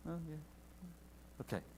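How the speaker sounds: normal talking voice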